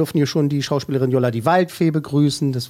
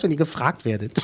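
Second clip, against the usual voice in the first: ordinary talk, nothing marked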